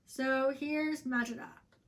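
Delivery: ordinary delivery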